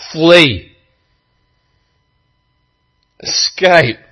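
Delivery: loud, high cry to flee; urgent warning shout. Retold or imitated. Soldier shouting a warning to the sailors. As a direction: yell the warning to run.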